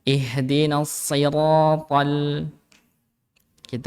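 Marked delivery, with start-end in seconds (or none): 0.0-2.1 siratal slow, to heavy; slow heavy recitation. Shown as a demonstration. Teacher models the heavy to for class. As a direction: recite Ihdinas siratal. slowly.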